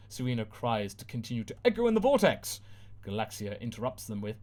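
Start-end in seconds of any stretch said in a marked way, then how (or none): none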